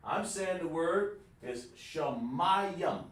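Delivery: "Shamayim" said slowly and deliberately, shə-MA-yim; slow model word. Shown as a demonstration. Teacher modelling the Hebrew word for students, slow and deliberate. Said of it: "The answer is how I say it".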